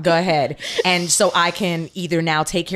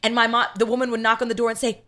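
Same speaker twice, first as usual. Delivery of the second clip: ordinary delivery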